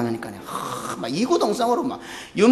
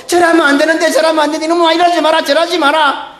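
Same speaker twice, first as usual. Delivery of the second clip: fond scolding; 10 semitones higher, 6 dB louder than usual. Retold or imitated. 목사가 성도 꾸짖는 흉내.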